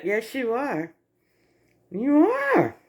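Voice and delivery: normal talking voice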